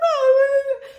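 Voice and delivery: high, loud imitated wail; mock wailing. Hurt daughter answering her panicked mom, mock wailing. Crying reply to running mom.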